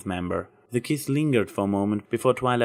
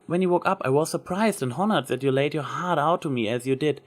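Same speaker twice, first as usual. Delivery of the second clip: higher, lightly voiced young mare; a shy admiring confession, pitched up; lightly voiced Twilight, admiring; Twilight's voice; 6 semitones higher than usual. A narrator's reading.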